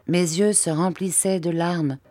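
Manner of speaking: plain reading voice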